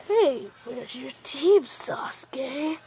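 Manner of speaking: soft, slow, creeping whisper trailing off; sly whisper. Retold or imitated. Kabuto slyly asking Sasuke about his team, whispering. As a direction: lean in and whisper it sneakily.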